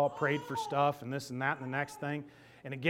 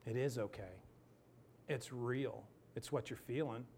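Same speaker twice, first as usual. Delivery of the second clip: softer, gentle church lady comfort; soft reassurance; 9 dB quieter than usual. Retold or imitated. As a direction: reassure him quietly. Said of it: "She goes".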